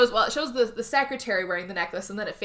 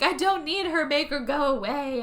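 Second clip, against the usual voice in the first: distraught insisting; 6 semitones higher than usual. Retold or imitated. Acts out distressed wife's plea.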